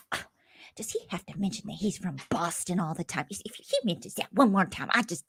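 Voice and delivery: a hint of a quiet, exasperated mom; imitating mother. Mother complaining about the Boston housemate, quiet and weary. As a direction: grumble it softly like a fed-up mother. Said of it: "my mother said ... she goes".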